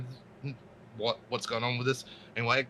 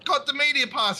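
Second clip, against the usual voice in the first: excited news; 13 semitones higher than usual. Retold or imitated. Telling friend the good news.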